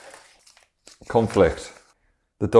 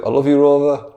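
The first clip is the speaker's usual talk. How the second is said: louder, higher farewell cry; 6 semitones higher, 8 dB louder than usual. Acted. Scientist saying goodbye to his dog, loud and high. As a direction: call out your love before the lever.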